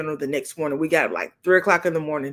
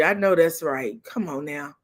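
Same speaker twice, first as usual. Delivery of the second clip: ordinary talk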